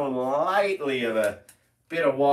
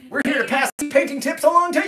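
loud, high and fast pitchman; shouting pitchman; 11 semitones higher, 5 dB louder, faster than usual. Acted. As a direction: bellow it like a car lot ad. Pitchman line repeated.

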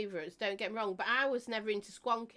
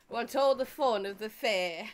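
a louder playful proclamation; mock rally cry; 7 dB louder than usual. Joking to viewers about the song. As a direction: call it out like a fairground barker.

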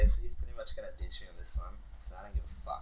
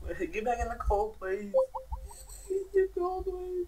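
pleading. Real, not acted. Pleading friend to rejoin.

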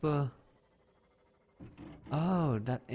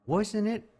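surprised; 9 semitones higher, 3 dB louder than usual. Real, not acted. Confused about where the ship is.

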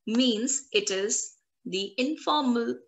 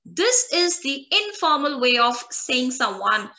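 ordinary delivery

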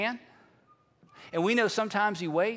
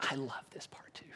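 low, near-whispered confiding; hushed aside; 9 semitones lower, 16 dB quieter than usual. Real, not acted. Preacher savouring the story, hushed aside to the congregation. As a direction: drop the voice right down.

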